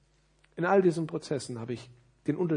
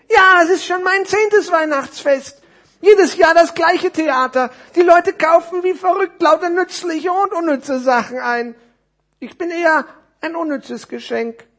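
very high, loud character rant; high-pitched storybook grumble; jaded little parcel; 14 dB louder than usual. Acted. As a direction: moan loudly as a fed-up tiny figure. Small parcel complains about Christmas.

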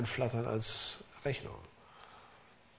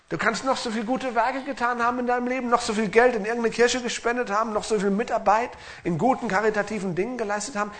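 full-force preaching; 9 semitones higher, 5 dB louder than usual. Acted. Preaching climax.

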